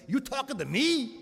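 high, incredulous challenge to heaven. Retold or imitated. Young man at Mass asking God, high and incredulous. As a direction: ask it high as if you can't believe it. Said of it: "I really said".

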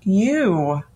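"you" drawn out on its own; "you" held long for emphasis; stress on YOU. Shown as a demonstration. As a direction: set "you" apart and lean on it.

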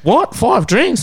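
high-pitched imitation of disbelief; mock shock. Singer hears of five drinks already, mock shock. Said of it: "they were shocked".

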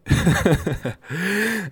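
high chuckling laugh; amused laugh. Real, not acted. Podcaster on a product nobody buys, laughing.

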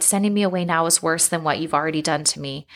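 plain reading voice

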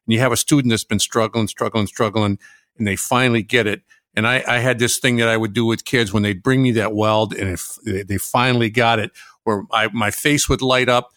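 normal talking voice

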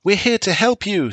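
put-on high child voice, insistent; child's voice. A narrator's reading. Son telling father they will help, high child voice. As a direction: speak up like a brave kid.

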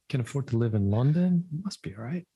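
ordinary talk, nothing marked

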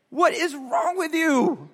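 high, slow exasperation; exasperated dad. Retold or imitated. Dad to son, slow and high. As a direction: stretch it out, fed up.

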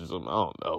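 mumbled, sinking into a soft low tail; trailing mumble. Real, not acted. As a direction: drop low and mumble it away. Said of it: "I don't know why I mumbled it".

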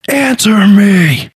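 high, slow, loud demand; exclaimed demand. A narrator's reading. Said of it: "exclaimed Zenris pointedly".

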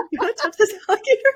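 high, fast, laughing mock question; giggling playful dare; laughing tease. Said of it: "And I was like".

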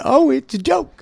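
high, bright "oh, it's a joke"; amused realization. Retold or imitated. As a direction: suddenly get the joke. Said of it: "and they go".

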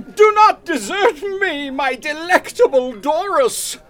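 high, loud, overwrought theatrical declaiming; hammy stage plea. Acted. Egbert to his fiancée Doris, ham actor's plea. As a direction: throw every line to the back row.

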